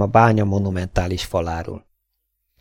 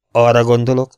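firm, louder interruption; interrupting with a raised, decisive voice; cutting in; 3 semitones higher, 6 dB louder than usual. A narrator's reading. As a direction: cut in with emphasis. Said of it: "vágott közbe Zénó kapitány".

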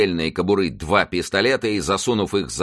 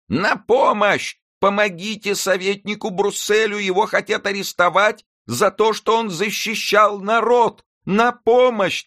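very high, loud, slow desperate yelling; screaming for help; 4 dB louder than usual. A narrator's reading. Nanette clinging to the window frame, yelling for help even louder. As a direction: wail it louder than ever. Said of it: "принялась вопить ещё сильнее".

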